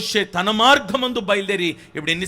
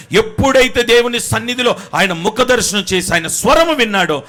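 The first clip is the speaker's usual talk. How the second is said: loud, higher preaching cry; fiery preaching; 7 semitones higher, 9 dB louder than usual. Acted. Preacher stirring the church about Moses, loud and fervent. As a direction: drive it home to the congregation.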